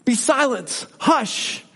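high, hushing rebuke; a high soothing shush; gentle command. Shown as a demonstration. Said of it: "One word".

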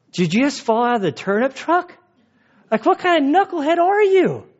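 rising to a high, scoffing knucklehead jab; mock incredulous. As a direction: tease him like he fell off a turnip truck. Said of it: "That's my version".

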